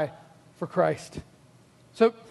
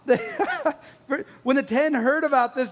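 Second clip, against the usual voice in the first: ordinary talk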